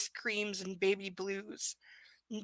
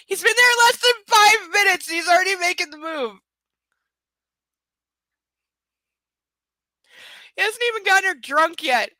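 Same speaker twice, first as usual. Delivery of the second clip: much higher, much louder indignant yelling; outraged shout; 10 semitones higher, 13 dB louder than usual. Real, not acted. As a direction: scream at the book in disbelief. Reader outraged at the book.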